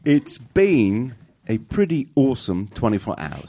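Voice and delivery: slow, careful Kiwi accent; New Zealand accent. Shown as a demonstration. Cohost copies Jordan from New Zealand, careful Kiwi accent. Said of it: "a New Zealand accent with me".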